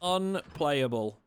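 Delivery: slow, drawn-out unplayable; slow disgusted verdict. Real, not acted. Streamer declaring the game unplayable, slow and fed up. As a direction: stretch the word in disgust.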